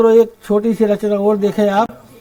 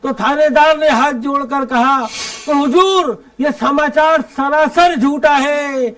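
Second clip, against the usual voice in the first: higher, measured stage verse with ringing line ends; comic poem recitation; 5 semitones higher than usual. Acted. Poet reciting a police satire to a festival audience, declaimed comic verse.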